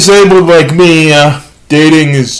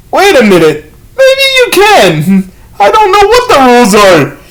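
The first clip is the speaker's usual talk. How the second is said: mock second thoughts; 10 semitones higher than usual. Uncle rethinks scolding his nephew, mock bewilderment. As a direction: climb high into acted bewilderment.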